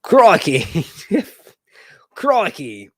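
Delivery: loud, drawn-out mock Australian exclamation; punchy imitation Aussie catchphrase; Australian accent. Shown as a demonstration. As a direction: warm up a hammy Australian accent. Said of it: "Let me do Australian".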